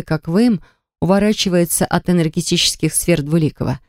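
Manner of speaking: ordinary delivery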